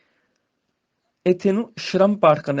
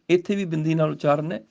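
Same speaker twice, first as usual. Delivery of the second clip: ordinary talk, nothing marked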